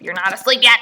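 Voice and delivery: loud scolding at the laptop. Real, not acted. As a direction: tell off the computer. Scolding her computer.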